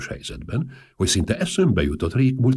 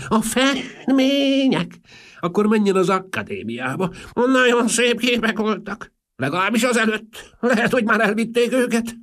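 Marchese; 5 dB louder than usual. A narrator's reading. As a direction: pipe it out like a dotty old aristocrat.

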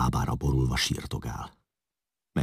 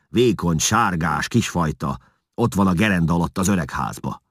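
calling out; 4 semitones higher, 5 dB louder than usual. A narrator's reading. Chieftain bellows at his wife.

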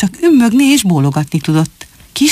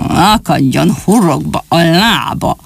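growled curse; slower than usual. Acted. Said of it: "mordult fel Rödfülű".

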